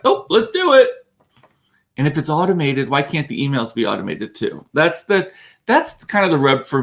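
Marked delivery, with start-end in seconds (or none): 0.0-0.9 high, breezy and carefree; careless mock quote. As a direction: toss it off like it's no big deal. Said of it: "they just are like".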